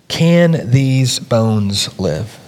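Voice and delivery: drawn out, each word "Can these bones live?" weighed; slow solemn question. Acted. Quoting God's question to Ezekiel.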